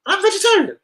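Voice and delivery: very high, squeaky mock astonishment; shocked exclamation. Regular hearing how long he's been coming, hammed-up shock. Acted astonished reply in a story.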